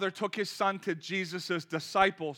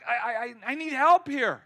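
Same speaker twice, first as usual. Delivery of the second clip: stammering, high and loud appeal; acted desperate appeal; urgent plea; 5 semitones higher, 8 dB louder than usual. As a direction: plead for help, stumbling on the first word. Acted believer's plea for help.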